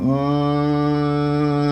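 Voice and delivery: long steady hum; held hum. Shown as a demonstration. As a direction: hold the hum on one note. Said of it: "back to an m".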